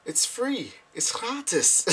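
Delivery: very high innocent plea; high-pitched protest. Retold or imitated. Speaker to the man at the door, high helpless protest.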